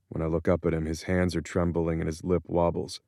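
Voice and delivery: usual speaking voice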